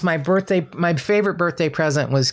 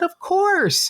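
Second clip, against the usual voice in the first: very high, gracious lilt; Keith Morrison. Retold or imitated. TV idol agrees to a fan's photo, high gracious lilt. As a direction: swoop the pitch way up, obligingly. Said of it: "And he said".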